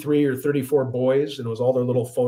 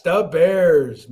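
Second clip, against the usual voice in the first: Chicago fan cheer; 3 semitones higher, 5 dB louder than usual. Shown as a demonstration. Streamer repeating da Bears to chat, Chicago accent. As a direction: echo the Chicago chant.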